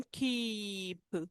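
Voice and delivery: कीप with a long stretched 'eee'. Shown as a demonstration. Teacher giving an example word, long-vowel कीप. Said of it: "long ई का sound आ रहा है".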